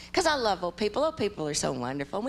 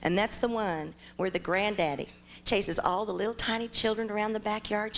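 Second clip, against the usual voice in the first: ordinary talk, nothing marked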